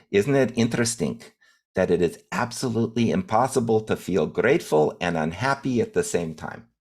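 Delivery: lightly voiced old Russian-accented immigrant musing. Great-grandfather sharing his wisdom, in a light Russian accent. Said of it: "and again, in his Russian accent".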